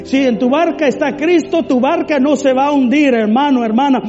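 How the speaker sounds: loud, high, forceful preaching; fervent deliverance prayer. Acted. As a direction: cast out fear with full authority. Oración de liberación ferviente. (oración ferviente, fuerte y aguda)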